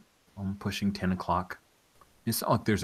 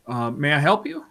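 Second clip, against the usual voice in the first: desk sergeant; 8 semitones higher, 6 dB louder than usual. Acted. Desk sergeant greets player.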